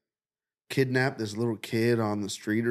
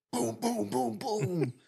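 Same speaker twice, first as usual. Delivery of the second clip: high, soft boom boom imitating shots; mock gunshots; 9 semitones higher, 3 dB quieter, slower than usual. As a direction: voice the gun going off lightly. Imitating gunshots in dream story.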